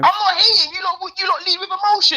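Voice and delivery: high-pitched, animated pushback; heated protest. Real, not acted. Host denying he is hating on women, heated protest.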